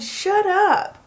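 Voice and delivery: high, loud squeal of disbelief; excited shout. Retold or imitated. Wife retelling her answer to the proposal, high excited shout. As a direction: blurt it out in happy shock. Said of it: "I said".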